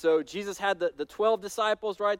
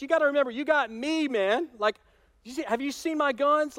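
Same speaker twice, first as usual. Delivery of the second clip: mock rebuke; 5 semitones higher than usual. Peter pulls Jesus aside and scolds him, high and pushy. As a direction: scold Jesus like an overconfident friend. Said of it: "He's like".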